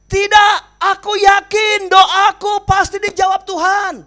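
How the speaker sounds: slow, loud stubborn shout. Acted. Old man on the wardrobe shouting at rescuers. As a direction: yell your refusal with full conviction. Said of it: "Kakek ini teriak".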